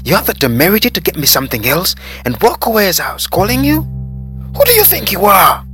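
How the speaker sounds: high, very loud indignant shouting; furious tirade. A narrator's reading. Customer berating waiter who walked away, furious. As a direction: berate him, climbing to a peak on the last question.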